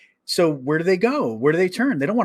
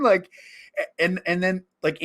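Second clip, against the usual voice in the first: normal talking voice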